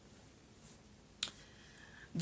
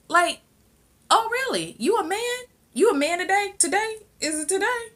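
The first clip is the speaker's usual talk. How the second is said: high-pitched, loud taunting questions; mocking incredulity; 6 dB louder than usual. Father challenges son in quarrel, high mocking disbelief. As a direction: scoff at him way up high.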